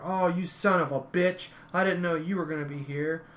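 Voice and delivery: lightly acted trash-talk shouting; retold yelling. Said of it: "they start yelling back and forth".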